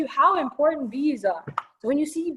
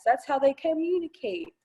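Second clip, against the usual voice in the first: ordinary delivery, nothing marked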